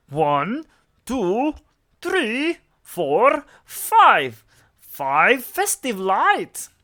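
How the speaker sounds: loud, slow dramatic counting. A narrator's reading. The Count counting the lights, loud and slow. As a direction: count each number with relish like a vampire. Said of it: "counted the count".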